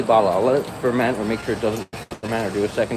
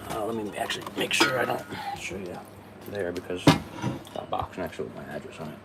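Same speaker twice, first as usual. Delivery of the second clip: whispered aside; 3 semitones lower, 8 dB quieter than usual. Real, not acted. Hides address, aside.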